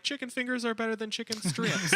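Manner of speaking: mocking country yard signs; mock sign slogan. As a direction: read it like a silly yard sign.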